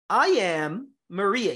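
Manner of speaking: slow, with "am" and "Ria" in "Maria" stressed. Shown as a demonstration. Teacher showing his students where the stress falls in "I am Maria". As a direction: lean on "am", then on "Ria". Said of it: "o forte aqui é no am".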